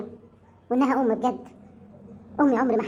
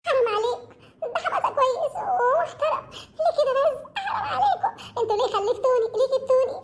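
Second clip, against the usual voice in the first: high, slow sobbing wail broken by laughter-like gasps; wailing sob; 12 semitones higher than usual. Acted. Daughter wails to her parents about wanting to die, sobbing high. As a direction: cry it out in despair, pitched way up.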